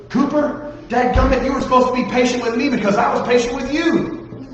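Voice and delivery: hammed-up exasperated yell at Cooper; girl snapping at Cooper for losing her patience, mock furious; loses her cool.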